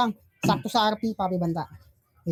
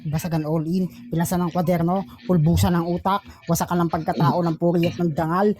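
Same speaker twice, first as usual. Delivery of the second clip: punchy hyped-up announcer call; battle host announcer; 4 semitones lower than usual. Acted. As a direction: hype the crowd like a ring announcer.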